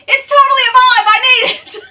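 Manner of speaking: very high, loud, rushed squeal; excited squeal. Acted. Vlogger reversing her opinion on the bag, squealing with excitement. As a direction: gush it, breaking into laughter.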